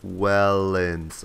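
"Wellens" drawn out slowly and evenly; sounded out. Retold or imitated. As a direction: stretch both syllables of "Wellens". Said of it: "I, like, sounded out".

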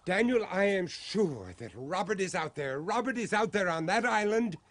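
can't hold back the hope; urgent excitement. Acted. Father sure his son lives.

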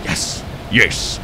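hushed, repeated little cheer; whispered yes. Real, not acted. Player as his plan works, whispered cheer. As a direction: whisper each yes to yourself.

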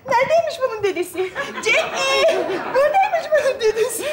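put-on playful cooing to a baby; sing-song peekaboo game voice; baby-talk peekaboo. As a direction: coo it teasingly, as if to an infant. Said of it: "Duygudan duyguya geçiyorsun ya".

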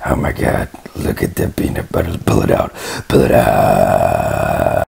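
loud unvoiced then bellowed cries; loud excited outburst. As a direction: shout it in frantic glee. Loud whispered then shouted.

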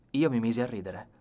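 plain reading voice

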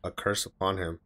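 low, subdued reading voice; subtitle read-aloud. A narrator's reading. Viewer reading anime dialogue to himself, subdued read-aloud.

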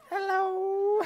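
mock-innocent hello; awkward hello. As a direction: chirp it to hide the shame. Awkward greeting stepping out.

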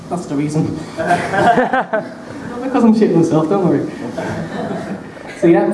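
usual speaking voice

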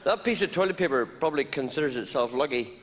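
usual speaking voice

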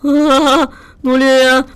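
high, loud yelp of alarm; startled shout. Real, not acted. Player jumps at a sudden scare in the game, startled shout.

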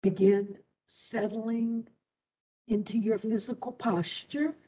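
ordinary delivery

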